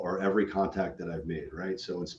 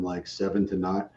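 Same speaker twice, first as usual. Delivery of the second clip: normal talking voice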